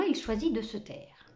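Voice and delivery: usual speaking voice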